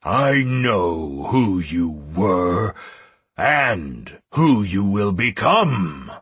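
very slow, weighty dragon voice; ponderous, stern pronouncement; commanding great dragon. Acted. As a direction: draw out each word with a dragon's authority.

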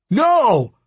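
high, loud scream. A narrator's reading. Narrator trapped in a smoke cage, screaming. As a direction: scream it out in desperation. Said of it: "I screamed".

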